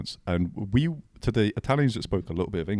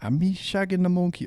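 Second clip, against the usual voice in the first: put-on Jamaican accent; 9 semitones higher than usual. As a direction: imitate a Jamaican guy from a video. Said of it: "a guy with a Jamaican accent".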